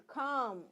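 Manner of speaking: calm said "kaam" with a drawn-out aa, no l; long vowel. Shown as a demonstration. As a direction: stretch the vowel of calm, silent l. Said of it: "long vowel". (calm में लंबा आ, ल चुप)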